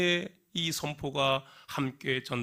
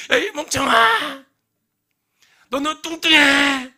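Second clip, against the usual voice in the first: high, slow, much louder yell; angry yell; 14 semitones higher, 14 dB louder, slower than usual. Acted. Upset girl screaming insults from the mountaintop, furious. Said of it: "열받았잖아".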